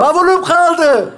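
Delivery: very high, drawn-out yell; stretched, high-pitched call; shouting. Acted. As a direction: yell slowly to someone far away. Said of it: "Ulan bağırsana".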